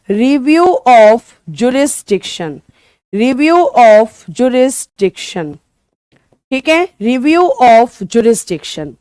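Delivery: very slow, every syllable drawn out; slow dictation. Shown as a demonstration. Teacher dictating the English term very slowly to her students. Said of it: "क्या बोलेंगे?".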